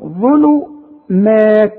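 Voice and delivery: ظلمات very slowly, thin "maat"; light meem. Shown as a demonstration. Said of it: "ما تعرفش تقول مات؟".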